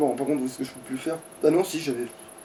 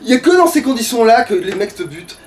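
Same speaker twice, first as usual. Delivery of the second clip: frustrated rant; 7 semitones higher, 6 dB louder than usual. Real, not acted. Killed again by enemies.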